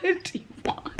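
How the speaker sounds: ordinary talk, nothing marked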